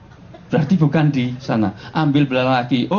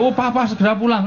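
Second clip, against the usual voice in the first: higher, urgent wife's call; wife phoning husband, raised pitch; voicing a wife; 7 semitones higher than usual. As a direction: call husband home in a panic. Wife calls husband home, joke.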